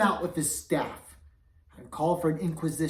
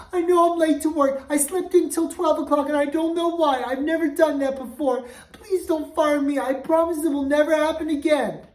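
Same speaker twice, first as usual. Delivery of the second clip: flustered pleading; 12 semitones higher, 10 dB louder than usual. Acted. Cook begs not to be fired.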